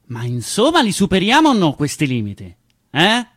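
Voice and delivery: loud pushy demand; mock impatient shout. People demanding you beat your limits, shouting. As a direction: needle them, loud and impatient. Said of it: "E poi ti dicono".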